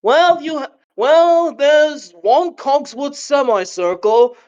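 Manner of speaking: slow, put-on British accent; a clumsy try at British, slowly; mock British accent, drawn out; poor British accent. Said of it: "My poor attempt of sounding British".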